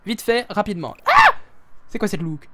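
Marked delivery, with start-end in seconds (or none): 1.0-1.3 sudden loud, very high cry; high shriek. Real, not acted. Streamer seeing the two girls' look, high shriek. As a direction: shriek as if caught off guard.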